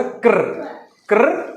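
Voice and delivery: ക്ർ as c and r run together; phonics-teacher ക്ർ; blended letter sound. Shown as a demonstration. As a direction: say ക്ർ as one bare blend. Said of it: "സിയും ആറും കൂടെ".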